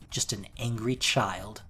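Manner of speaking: ordinary talk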